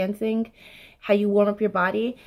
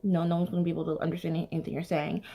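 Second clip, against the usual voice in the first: mumbling demo; 3 semitones lower, 8 dB quieter, faster than usual. Shown as a demonstration. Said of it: "you can't talk like this".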